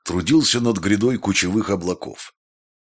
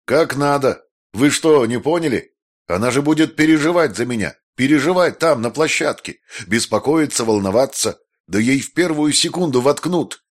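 higher, louder, rushing rant; agitated rant; 6 semitones higher, 3 dB louder than usual. A narrator's reading. Maestro ranting at Pshederetsky about Jessica, higher agitated rant. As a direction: spill it out in a frenzy.

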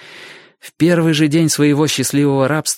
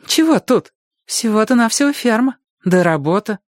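put-on girl's voice; 11 semitones higher than usual. Girl voiced in dialogue.

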